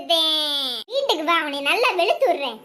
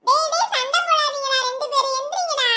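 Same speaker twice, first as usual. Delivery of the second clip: high, raised voice stopping a fight; scolding elder; 9 semitones higher than usual. Acted. Elder telling two boys to stop fighting, scolding high. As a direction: break up the boys' squabble. Adult figure breaks up fight.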